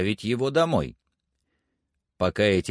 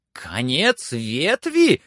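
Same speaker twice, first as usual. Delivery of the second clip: higher, drawn-out incredulous question; excited cry; 5 semitones higher, 3 dB louder, much slower than usual. A narrator's reading. Legrand reacts to branch's end, high excited cry. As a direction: stretch the question upward. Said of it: "вскричал Легран".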